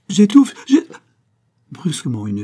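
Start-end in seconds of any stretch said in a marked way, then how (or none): 0.1-0.8 high, loud strangled cry; choking. A narrator's reading. Old locksmith seized by suffocation before Malouin, choking panic. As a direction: cry out as the air runs out.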